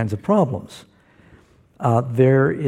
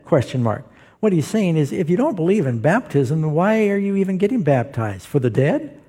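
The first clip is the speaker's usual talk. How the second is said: ordinary delivery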